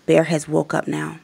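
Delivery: plain reading voice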